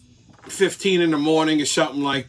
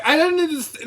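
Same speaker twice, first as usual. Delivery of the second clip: mocking clueless girl; 9 semitones higher than usual. Mimics the girl again.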